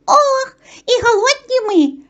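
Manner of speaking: high, loud mouse moan; hungry mice. A narrator's reading. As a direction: wail it as starving little mice. Hungry mice complain to the rooster.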